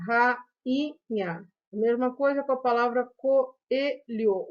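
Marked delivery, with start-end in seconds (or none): 0.1-1.5 "rainha" slowly as ra, i, nha; slow syllable-by-syllable "rainha"; split into syllables. Shown as a demonstration. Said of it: "podemos pronunciar". 3.2-4.5 "coelho" broken as co, e, lho; separated syllables in "coelho"; split into syllables. Shown as a demonstration. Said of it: "O E vai ficar numa sílaba separada".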